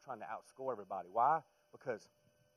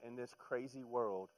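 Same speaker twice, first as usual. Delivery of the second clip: ordinary talk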